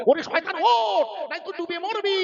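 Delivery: urgent call. Retold or imitated. Father Nuh urgently calling his son aboard.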